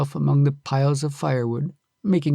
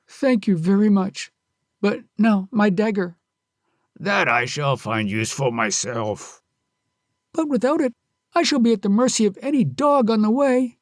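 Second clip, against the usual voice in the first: pleading Kyusuke; 6 semitones higher than usual. Acted. Kyusuke pleading with the robber for his dagger. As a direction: press for the dagger.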